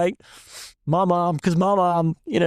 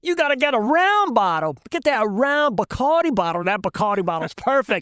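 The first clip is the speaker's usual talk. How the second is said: raised, insistent Cajun voice; thick Cajun accent; 5 semitones higher than usual. Retold or imitated. Cajun shrimper recommending the right bottle, thick Cajun accent. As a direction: imitate a Cajun shrimper laying down the rule. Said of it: "Bernard says in that thick Cajun accent".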